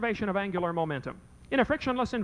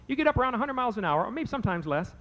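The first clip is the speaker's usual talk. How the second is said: normal talking voice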